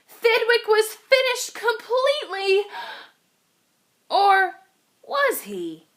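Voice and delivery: ordinary delivery, nothing marked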